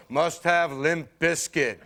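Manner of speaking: slow, zombie-like chant; brainwashed music buyer. Acted. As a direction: drone it as if hypnotised. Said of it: "one day you just snap".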